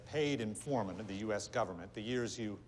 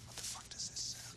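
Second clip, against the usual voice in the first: hushed courtroom whisper; 9 dB quieter than usual. Acted. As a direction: whisper so the judge can't hear. Passing notes quietly in court.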